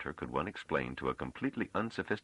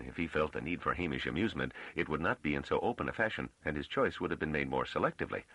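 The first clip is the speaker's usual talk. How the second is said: plain reading voice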